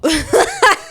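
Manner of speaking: high, loud laughing burst; burst of laughter. Real, not acted. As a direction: crack up at the dark joke.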